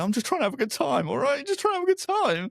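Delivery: high, fast defensive whine; whiny soldier. Acted. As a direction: pitch up and rattle it off, hurt.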